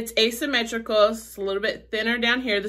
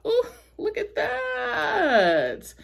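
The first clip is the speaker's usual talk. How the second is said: excited; 12 semitones higher, much slower than usual. Real, not acted. Showing off earrings.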